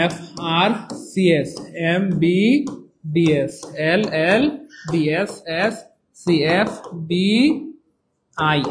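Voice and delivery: letter by letter, very slow; slow spelled out. Shown as a demonstration. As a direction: spell each letter slowly for the class. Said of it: "এই যে এগুলো ভাওয়াল না". (খুব ধীরে অক্ষর ধরে ধরে)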